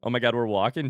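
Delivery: ordinary talk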